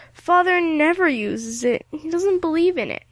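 moaning. A narrator's reading. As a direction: whine it out like a miserable invalid. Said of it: "moaned Ilse".

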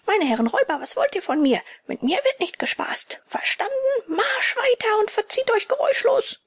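high, cocky yelling at robbers; imagined bold shout. Pretend. Puppet imagines yelling at robbers, high cocky bellow. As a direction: puppet brags he'd roar them off. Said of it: "sie anbrüllen".

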